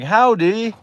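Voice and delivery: high, drawn-out British hello; singsong put-on British accent; imitated British greeting. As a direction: hail a cowboy in mock British. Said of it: "they're just like".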